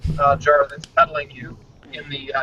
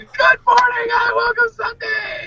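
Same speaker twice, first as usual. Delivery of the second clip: excited greeting; slower than usual. Real, not acted. Host bursting into the live show.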